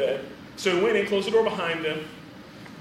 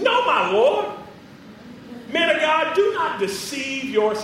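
frustrated woman; 6 semitones higher, slower than usual. Acted. Woman refusing to believe the prophet, frustrated. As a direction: drag out the protest in disbelief. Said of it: "like she was frustrated".

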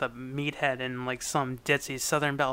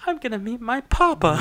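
higher-pitched, hammed-up earnestness; mock profound; 8 semitones higher, 4 dB louder than usual. Daughter about to meet her father, mock profound. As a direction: make it a momentous announcement. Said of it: "this very profound".

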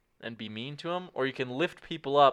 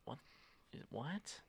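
very quiet, rising disbelief; imitating confused opponents under the breath; hushed, incredulous questioning; baffled; 4 semitones higher, 14 dB quieter than usual. Imagined opponents confused by niceness.

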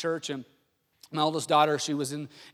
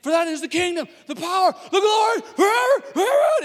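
building to a very high, loud yell; rowdy victory shout; 13 semitones higher, 9 dB louder than usual. Retold or imitated. Winning team ending the Lord's Prayer, escalating celebratory shout. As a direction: shout it higher and higher to the finish. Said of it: "by the time they got to the end".

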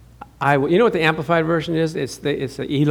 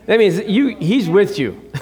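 ordinary delivery

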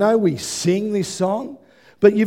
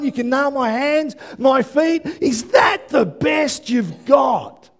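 defiant shout; 3 semitones higher than usual. Acted. Preacher shouting God's taunt to death.

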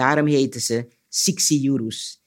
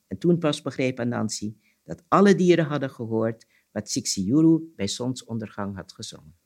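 usual speaking voice

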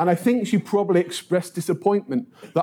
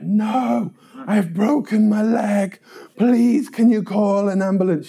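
high-pitched, exasperated mock pleading; desperate plea; 5 semitones higher than usual. Paperboy asking woman for an ambulance, pleading. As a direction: beg to be believed, pitched up.

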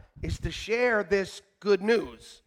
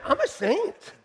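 put-on much higher, sing-song cheeky teen; high cheeky teenage mock voice; mock teen reply; 10 semitones higher, slower than usual. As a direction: sass the yelling parents.

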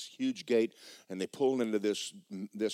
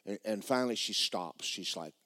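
ordinary delivery

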